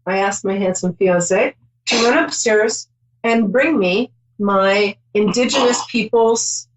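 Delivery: ordinary talk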